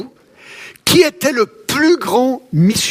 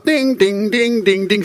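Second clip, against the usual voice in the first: toy robot dings; slower than usual. Retold or imitated. Imitating a wind-up toy robot.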